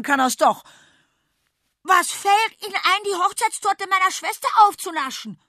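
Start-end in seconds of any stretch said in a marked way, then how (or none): 1.8-5.4 put-on boy bellowing, high and loud; furious shout. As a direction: roar at the cook in outrage. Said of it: "brüllt er".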